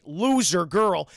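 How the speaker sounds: usual speaking voice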